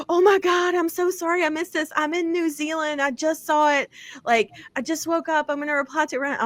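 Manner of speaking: high-pitched, flustered apology, imitating her. Acting out author's apologetic reply.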